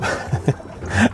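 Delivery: high, airy silent laugh; breathless laugh. Real, not acted. Teller laughing at the chief's cup, airy high laugh. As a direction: laugh without voice at the memory.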